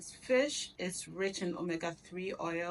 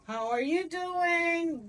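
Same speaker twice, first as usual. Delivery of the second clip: high playful voice; 10 semitones higher, 4 dB louder, much slower than usual. Acted. Playful high greeting to the food.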